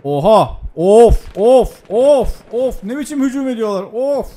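loud, high cries of dismay; alarmed outburst. Real, not acted. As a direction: groan out each "of" loudly. Startled by attack in game.

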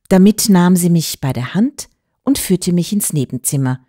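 plain reading voice

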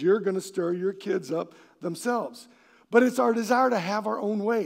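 ordinary talk

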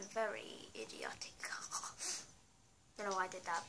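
a sheepish self-scolding whisper; under the breath; whispered aside. Real, not acted. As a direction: whisper it to yourself. Scolding himself after mistake.

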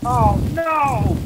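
loud, high cry of alarm; alarmed shout. Real, not acted. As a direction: yell out in sudden alarm. Sudden crash in game.

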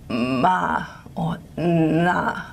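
slow, drawn-out ma and na in marked voice qualities; breathy and creaky nasals. Shown as a demonstration. Teacher demonstrating breathy and creaky nasals to students. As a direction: voice ma and na with breathy then creaky phonation. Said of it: "breathy voiced or creaky voiced nasals".

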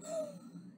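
high, breathy gasp of surprise; sharp gasp. Acted. The parents gasp at the tickets. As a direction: catch your breath in shock. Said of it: "they gasped".

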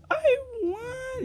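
high squealing cry of victory; shrieking jackpot winner. Real, not acted. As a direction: shriek it like you hit the jackpot. Wins the fashion show.

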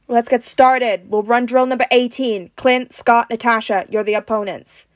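loud team-leader briefing; commanding. A narrator's reading. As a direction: give orders to the whole group.

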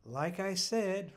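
prim. A narrator's reading. Mother resuming her lecture, unmistakably prim. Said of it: "in an unmistakably prim tone".

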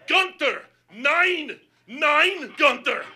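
high, loud urgent orders; shouted commands. Retold or imitated. German-commanding dog handler calling off Gunther, shouting.

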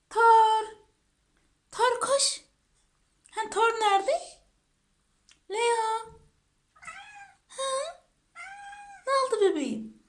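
high singsong call to a dog; pet-calling voice. Real, not acted. (tiz sesle evcil hayvan çağırır gibi)

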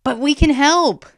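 high, loud, urgent objection; protesting. A narrator's reading. Boy objecting to being sent inside, protesting. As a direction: plead to be allowed to help. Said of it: "Zin protested".

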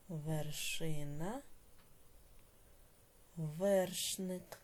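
words drawn out one by one; slow dictation. Shown as a demonstration. Teacher dictating word pairs to pupils, slowly. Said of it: "teacher dictates words slowly".

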